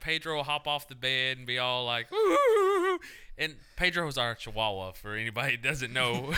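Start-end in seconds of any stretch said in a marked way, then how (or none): 2.1-3.0 high yelpy dog whine; dog whimper. Retold or imitated. Dog voiced in sex story.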